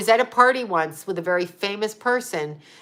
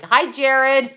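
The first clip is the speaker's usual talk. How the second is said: higher, louder sing-song "Hi, Jared"; sarcastic chirpy hello; cheesy mocking greeting; 5 semitones higher, 5 dB louder than usual. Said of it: "that's like".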